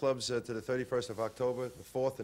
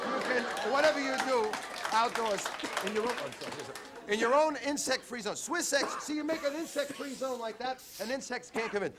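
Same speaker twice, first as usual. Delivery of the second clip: high, upbeat sales pitch; ad read; 9 semitones higher than usual. Acted. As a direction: sell it like a TV ad.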